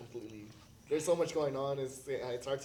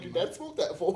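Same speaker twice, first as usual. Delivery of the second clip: laughing quote; 5 semitones higher, 7 dB louder than usual. Retold or imitated. Retelling reaction, laughing.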